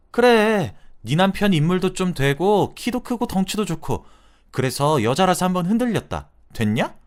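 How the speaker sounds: high, loud defiant confession; defiant yell. Retold or imitated. Friend screams at the wife that she was tempted, defiant yell. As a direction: throw the admission in her face.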